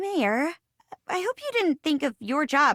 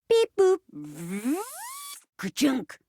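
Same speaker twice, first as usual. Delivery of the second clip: high beeps, then a mechanical clunk; mouthed robot noises; 8 semitones higher than usual. Acted. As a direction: imitate a machine reconfiguring with the mouth. Imitating robot transforming.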